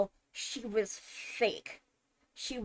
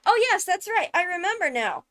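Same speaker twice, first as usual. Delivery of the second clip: sudden realization; 8 semitones higher, 5 dB louder, faster than usual. Real, not acted. Sudden recall mid-explanation.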